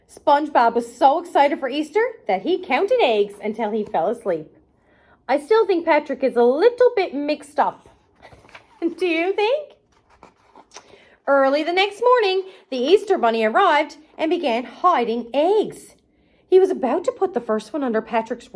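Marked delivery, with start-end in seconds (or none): none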